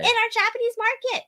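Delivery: high-pitched, incredulous answer; incredulous correction. Real, not acted. Co-host correcting him on where sandwiches are sold, incredulous. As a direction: correct them as if it should be obvious.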